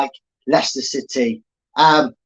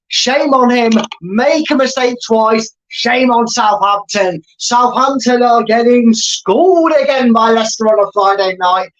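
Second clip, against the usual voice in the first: elated commentary; 5 semitones higher than usual. Real, not acted. Fan commentator gloating at Southampton after the goal, high and elated. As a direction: rub it in, still buzzing.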